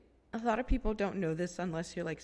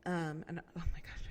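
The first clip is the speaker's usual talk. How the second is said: ordinary talk, nothing marked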